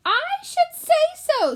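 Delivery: very high, loud squeak; squeaky. Acted. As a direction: squeak it out, affronted. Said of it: "squeaked Gilbert".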